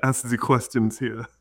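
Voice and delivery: put-on loud, high German-accented opening, breaking into a laugh; hammed-up German interrogator with a laugh; mock German accent. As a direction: bark it in a joke German accent. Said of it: "the Hitler knock knock joke".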